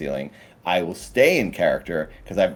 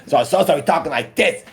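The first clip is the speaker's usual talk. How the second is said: Massachusetts loudmouth; 9 semitones higher, 4 dB louder, faster than usual. Shown as a demonstration.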